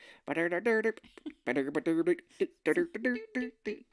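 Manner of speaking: theme tune in wordless rapid syllables; imitated old-time tune. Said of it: "imagine it in that time being like".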